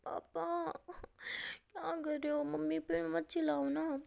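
put-on half-asleep child begging papa to stop; drowsy daughter. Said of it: "तो वैसे वो नशे में होती है". (नींद में डूबी बेटी की ऊँची, धीमी विनती)

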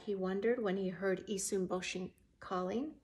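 plain reading voice

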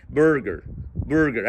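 برجر as "berger", light English vowels; English-style burger; correct pronunciation. Shown as a demonstration. Customer ordering a sandwich, correct pronunciation. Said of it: "هو اللي غلطان مش أنت".

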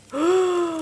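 loud sharp gasp; surprised gasp. Real, not acted. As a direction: gasp in delight. Unwraps a surprise gift.

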